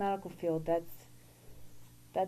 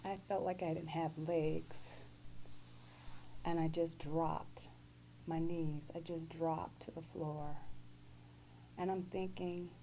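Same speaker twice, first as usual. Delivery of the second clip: ordinary talk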